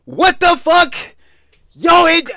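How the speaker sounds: high, loud cries of disbelief, slow; exasperated yelling. Real, not acted. Reacting to the clip, yelling in exasperation. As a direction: shout like you can't take it.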